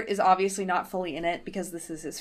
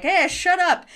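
high, loud retort; mock rebuke; 10 semitones higher, 6 dB louder, slower than usual. Henchman silencing yelling Taya, loud mock rebuke. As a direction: snap it loudly as an imitated henchman.

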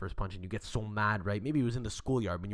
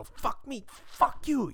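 pretend outburst; slower than usual. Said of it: "you go".

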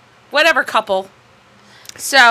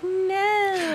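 mock breathy wife; 9 semitones higher, 6 dB quieter than usual. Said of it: "she's like".

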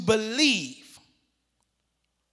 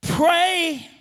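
cry out; 7 semitones higher, 4 dB louder than usual. Acted. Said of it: "The Father cries out".

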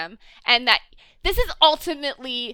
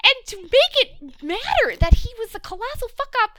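high-pitched ranting; 9 semitones higher than usual. Real, not acted. Fan venting about the writers' missed chance, high-pitched. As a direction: voice pushed way up in exasperation. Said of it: "I'm reaching ultrasonic levels of pitch".

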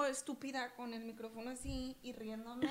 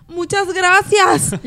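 grand thank you; 7 semitones higher, 18 dB louder, much slower than usual. Retold or imitated. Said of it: "yo me levanto y digo".

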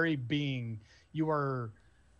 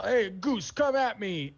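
raised, higher mock challenge; imitated yell; 6 semitones higher, 5 dB louder than usual. Game master echoing the player's taunt, mock yelling. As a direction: echo the player's taunting shout. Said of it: "as you yell".

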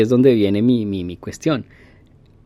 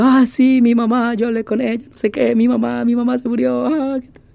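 imitated bawling; 11 semitones higher than usual. Driver still bawling about his mother as he drives on, high mock wail. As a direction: keep wailing like the grieving driver. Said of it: "como berreando".

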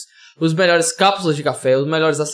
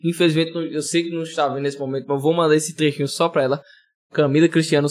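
ordinary talk, nothing marked

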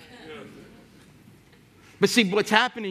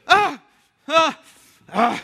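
mock protesting ahs; 9 semitones higher, 5 dB louder, slower than usual. Aunt waving off the call.

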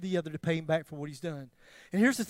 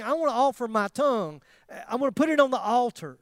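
louder, impassioned preacher's plea; preaching; 6 semitones higher, 7 dB louder than usual. Acted. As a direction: push the confession home. Preaching the tongue confession.

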